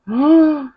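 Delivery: sharp, high excited gasp; delighted gasp. Real, not acted. Gasping to viewers over favorite beef fajitas, delighted gasp. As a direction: gasp like the taste just hit you.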